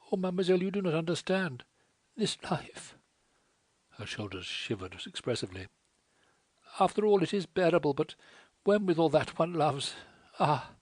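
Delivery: put-on a wretched girl begging her to leave; distressed French girl. A narrator's reading.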